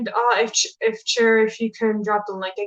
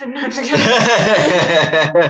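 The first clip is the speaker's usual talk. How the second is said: loud, drawn-out laugh through the words; 7 dB louder, much slower than usual. Real, not acted. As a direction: crack up at your own teasing. Laughs after teasing the host.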